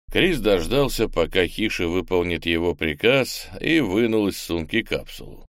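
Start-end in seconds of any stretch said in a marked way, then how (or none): none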